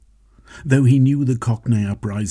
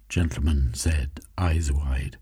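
plain reading voice

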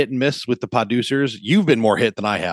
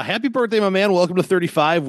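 excited birthday wish; 9 semitones higher than usual. Real, not acted. Birthday wishes to friend.

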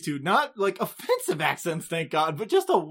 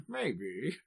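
mock British through laughs; 4 dB quieter, much slower than usual. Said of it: "It's constantly saying".

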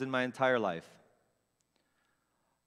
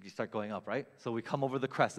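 usual speaking voice

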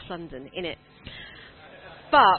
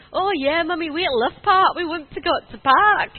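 imitating eager children, higher and louder; excited little boys; 7 semitones higher, 9 dB louder than usual. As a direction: mimic excited kids begging Mummy. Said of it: "the boys are so excited".

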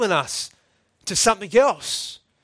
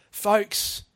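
ordinary delivery

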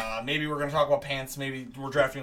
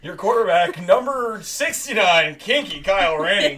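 louder stadium intro, slow on the number; mock announcer; 3 semitones higher, 8 dB louder than usual. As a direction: introduce the quarterback like a hammed-up stadium announcer, laughing.

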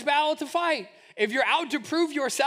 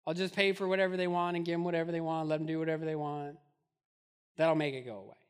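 defeated mumble; 6 semitones lower, 5 dB quieter, faster than usual. Retold or imitated. Mimicking defeated parent.

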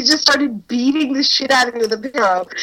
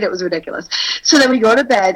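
normal talking voice

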